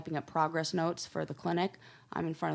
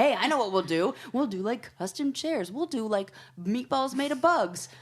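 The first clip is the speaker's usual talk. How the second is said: bright, higher, eager pitch; excited kids voice; 6 semitones higher, 3 dB louder than usual. Retold or imitated. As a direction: pitch it up like giddy heirs. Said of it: "the kids are like".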